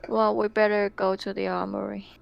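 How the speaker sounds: normal talking voice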